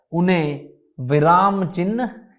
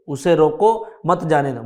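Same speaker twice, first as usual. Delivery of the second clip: "उसे रोको", clear break after रोको, then "मत जाने दो"; phrase it as उसे रोको / मत जाने दो; pause after रोको. Shown as a demonstration. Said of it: "यदि रोको के आगे लग जाता है".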